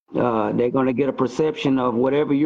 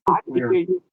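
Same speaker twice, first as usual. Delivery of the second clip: phone held away; faster than usual. Real, not acted. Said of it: "'cause we can't hear you".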